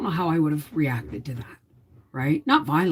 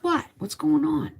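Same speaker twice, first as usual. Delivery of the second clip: husband's concerned question, pitched way up; imitating husband; 12 semitones higher than usual. Wife quoting her husband checking on her, in a high mock voice. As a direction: mimic him asking what's wrong, much higher. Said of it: "He goes".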